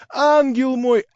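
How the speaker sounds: high, loud endearment; excited shout. A narrator's reading. Young man calling Natasha his angel, excited. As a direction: call her an angel in rapture. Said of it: "кричал он".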